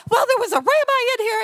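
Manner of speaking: high, fast mock whine; hysterical, acted. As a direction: blurt it out in comic hysterics. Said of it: "I'm hysterical".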